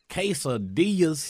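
slow, drawn-out commercial read; ad announcer voice. Pretend. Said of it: "one ad you did with Chili's".

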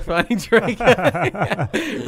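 slow, high, laughing drawl. Real, not acted. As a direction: stretch it out while laughing. Laughing at own podcast name.

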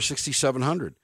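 normal talking voice

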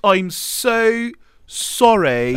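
put-on overdone British accent, pleading; terrible British accent. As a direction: stretch it out in hammy British. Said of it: "The British accents".

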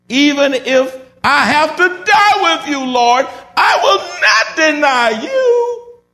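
high, loud, drawn-out impassioned cry; bold vow. Acted. Preacher as Peter swearing loyalty to the Lord, bold high shout. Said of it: "now he was talking boldly".

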